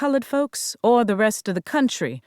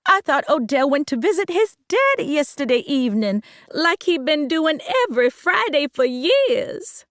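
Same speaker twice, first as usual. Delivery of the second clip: voiced mama calling out, pitched higher; a hint of an upset mother hollering; mild holler; 8 semitones higher than usual. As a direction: call it out with a light holler. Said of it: "Mama hollered".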